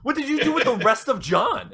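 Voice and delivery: high-pitched comic scolding, half laughing; horrified disbelief. Acted. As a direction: protest in mock alarm, voice way up. Horrified roommate confronting zombie friend.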